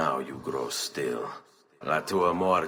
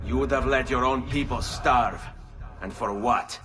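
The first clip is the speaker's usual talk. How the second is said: raised, accusing voice; angry accusation; 8 semitones higher, 4 dB louder than usual. Acted. Ezio confronting a dying man, angry. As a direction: demand an answer, hard and loud.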